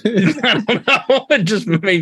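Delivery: giggling through the words; laughing. Real, not acted. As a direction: laugh it out slowly. Laughing at the cat joke.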